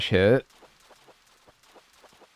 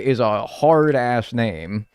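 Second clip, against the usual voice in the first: usual speaking voice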